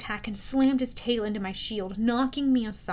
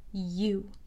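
lower, softer, disdainful; distaste; 4 semitones lower, 6 dB quieter than usual. A narrator's reading. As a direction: spit the word with contempt. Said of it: "she said with distaste".

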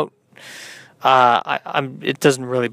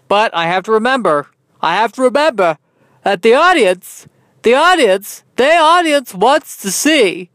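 high, emphatic theatrical voice; hammed-up high, grandiose delivery; odd put-on voice; 7 semitones higher, 4 dB louder than usual. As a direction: swoop up high and declaim it. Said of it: "I don't know why I'm talking in that voice".

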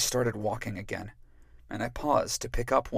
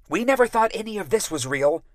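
much higher, louder sharp retort; shot back; 8 semitones higher, 8 dB louder, faster than usual. Acted. Rémi retorting to his sister, sharp and loud. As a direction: fire it back at her. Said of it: "he shot back".

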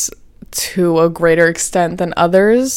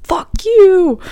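much higher squeaky mock voice; high cartoon voice spitting it back; cartoonish fuck you; 12 semitones higher than usual. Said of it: "And then they're both like".

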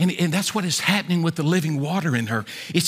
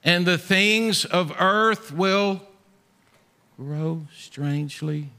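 slow hymn recital; 3 semitones higher, much slower than usual. Acted. Preacher closing the sermon with a slow, tender hymn recital. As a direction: linger on each hymn line.

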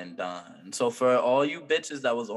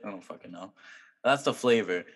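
normal talking voice

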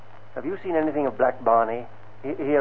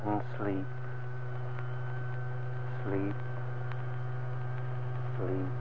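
hypnotic soothing; 10 dB quieter, slower than usual. Acted. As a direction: fade each sleep softer. Hypnotist putting man to sleep.

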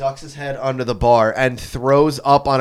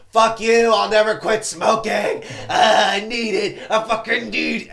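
put-on drunk friend; 8 semitones higher than usual. Drunk friend quoted in bar story.

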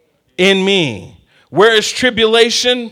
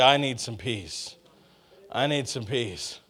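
low, softly voiced plea; quiet weary plea; 7 semitones lower, 13 dB quieter than usual. Preacher voices someone longing for peace, quiet weary plea. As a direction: say it low and much softer, as if to yourself.

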